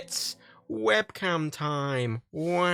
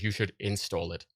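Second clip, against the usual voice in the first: lower, softer deadpan quote; flat mock software message; 8 semitones lower, 8 dB quieter than usual. Vlogger mocking the webcam software prompt, deadpan. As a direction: read the useless prompt back flatly. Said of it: "It just says".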